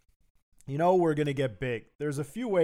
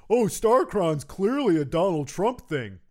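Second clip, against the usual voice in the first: know-it-all fan; 5 semitones higher, 6 dB louder than usual. Retold or imitated. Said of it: "start acting like".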